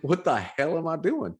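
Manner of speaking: high, incredulous inner voice; mock bewildered self. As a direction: squeak out your own disbelief. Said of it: "and it's like".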